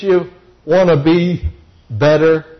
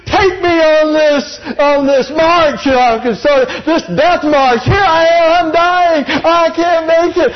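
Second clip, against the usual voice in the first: put-on much higher, slow whiny child's complaint; mad little kid; 12 semitones higher than usual. Tired boy complaining to his brother on the long trail, high and whiny. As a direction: whine like an exhausted, furious small boy. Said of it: "I was so mad".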